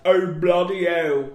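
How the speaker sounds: slow, loud mock Cockney accent. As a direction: ham up a Cockney "Oh, bloody hell". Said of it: "That's Cockney".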